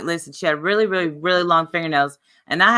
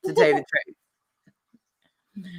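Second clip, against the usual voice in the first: hammed-up Waterboy accent; 4 dB quieter, much faster than usual. Streamer joking about a rival, Waterboy accent. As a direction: imitate the Waterboy's comic accent. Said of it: "I am doing the Waterboy accent".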